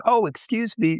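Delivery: high, breathy-free imitation of a gushing woman; a lightly mocking high fan voice; mimicking a stranger at the bar. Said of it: "She goes".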